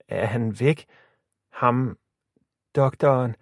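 hesitant, broken-up question; cautious. A narrator's reading. Emma asks whether the doctor is gone, cautiously. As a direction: ask carefully, with pauses. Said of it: "spurgte Emma forsigtigt".

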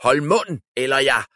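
loud, high menacing order; furious wizard silencing his slaves; threatening. A narrator's reading. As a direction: cut them off with a threat.

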